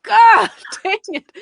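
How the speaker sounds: high, loud, drawn-out cry of frustration; mock howl of frustration, laughing through it; frustrated at yourself, hammed up; exasperated outburst. Retelling being corrected again and again.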